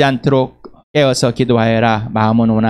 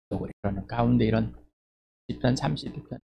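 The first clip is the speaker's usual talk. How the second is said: ordinary talk, nothing marked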